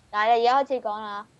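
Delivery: ordinary talk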